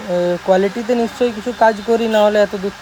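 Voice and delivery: ordinary talk, nothing marked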